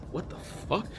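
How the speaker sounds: breathless whispered disbelief; stunned whisper. Retold or imitated. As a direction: mouth it in shock, no voice. Retold shocked reaction to the demand.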